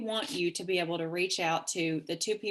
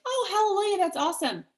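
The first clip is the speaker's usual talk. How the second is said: thrilled; 12 semitones higher, 5 dB louder than usual. Retold or imitated. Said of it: "And I'm like".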